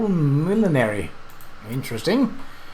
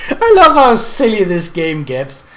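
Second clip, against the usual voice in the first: laughing while talking; giggling through the words; 6 semitones higher than usual. Real, not acted. Amused by the silly game.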